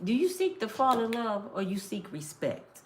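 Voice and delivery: put-on quiet, measured question to the girls; asking the mentees. Mentor quietly asking the girls a question. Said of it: "I said".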